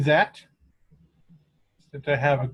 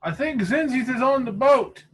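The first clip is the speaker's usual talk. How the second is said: lightly acted, higher-pitched adventurer; higher character voice answering a shout; in-character lookout reporting back; 9 semitones higher than usual. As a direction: call the sighting back to a companion.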